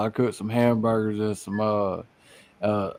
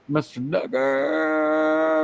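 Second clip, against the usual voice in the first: drawn out; 4 semitones higher, much slower than usual. Real, not acted. Drawn-out name.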